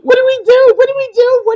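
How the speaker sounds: high, loud panicky repeats; frantic, voice jumping up in alarm; panicked. Retold or imitated. People in a panic asking what to do.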